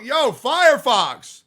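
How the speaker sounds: high, loud, drawn-out greeting; real excited callout; astonished shout. Real, not acted. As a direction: yell the name in amazed recognition. Recognizes an old supporter in chat.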